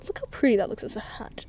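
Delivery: soft unvoiced aside; hushed little aside; whisper. Real, not acted. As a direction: whisper it to the camera. Aside to viewer.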